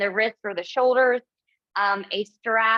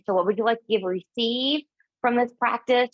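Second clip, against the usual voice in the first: ordinary talk, nothing marked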